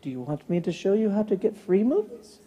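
quiet, secretive offer; hushed. Retold or imitated. Repairman quietly offers the pastor free movies, hushed. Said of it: "all of a sudden it got quiet".

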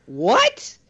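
high, rising mock-baffled question; exaggerated incredulous teacher; feigned confusion. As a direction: play dumb, pitch shooting up. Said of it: "I'll be like".

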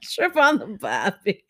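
slow, exaggerated Australian accent with a laugh. Shown as a demonstration. Retries her Australian accent for a friend, slow and hammed-up. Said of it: "what's your Australian accent sound like?".

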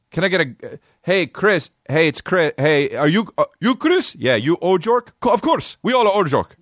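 high, put-on voices for the driver and rider; acted Uber pickup. Comic acts out a rider and Uber driver meeting, high and hammed-up. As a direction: play both sides of a silly pickup.

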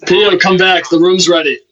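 loud call from across the room. Real, not acted. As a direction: call him back loudly. Calling across room to Pio.

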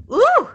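loud sudden sneeze; big explosive sneeze; sneezing. Real, not acted. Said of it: "I'm about to sneeze".

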